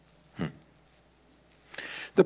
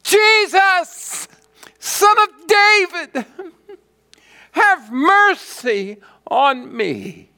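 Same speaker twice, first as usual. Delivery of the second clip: even more piercing, high, loud plea; crying out all the more; 5 dB louder, slower than usual. Acted. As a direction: shout louder over those hushing you, drawing out the plea. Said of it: "he cried out all the more".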